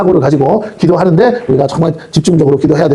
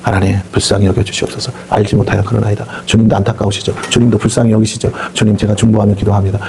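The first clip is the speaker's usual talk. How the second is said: humble prayer; 6 semitones lower than usual. Retold or imitated. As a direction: pray gently for the enemy. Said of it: "이렇게 하면 안 돼".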